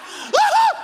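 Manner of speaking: very high, loud shrieking scream; startled scream. Retold or imitated. Pastor shows his scream at meeting his wife in the hallway, piercing and high. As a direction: shriek like you've been jumped in the dark. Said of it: "That's my response".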